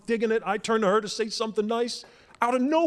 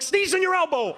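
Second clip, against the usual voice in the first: much higher, sharp nagging order; mock scolding; 11 semitones higher than usual. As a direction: bark the rule at him mid-sneeze. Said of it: "She's like".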